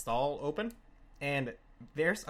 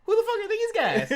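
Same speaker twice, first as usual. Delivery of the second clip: much higher, louder yelled question; outraged yell; 7 dB louder than usual. Retold or imitated. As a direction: yell in disbelief.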